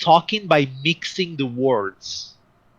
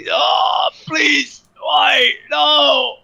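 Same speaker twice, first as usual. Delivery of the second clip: high-pitched wail of a wounded captive; acted high, anguished cries; screaming and pleading; 13 semitones higher, 4 dB louder, much slower than usual. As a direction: scream as you're dragged by the leg. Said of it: "Stop screaming".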